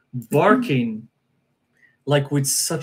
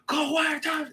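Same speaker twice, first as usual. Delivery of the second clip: high-pitched mock yell, held back in volume; imitated screaming; 6 dB quieter than usual. Storyteller imitating the man chasing the dog away, mock scream. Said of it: "while screaming loud".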